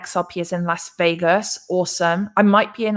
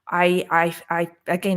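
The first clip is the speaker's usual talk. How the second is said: usual speaking voice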